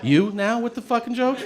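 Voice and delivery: high, incredulous complaint; mock-outraged patient; exasperated. Patient snapping at the joking doctor, incredulous.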